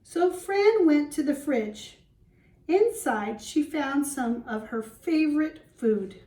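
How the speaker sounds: put-on lively read-aloud for young children; storybook reading. Teacher reading to the class, storybook voice. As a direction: read it like a picture book to the class.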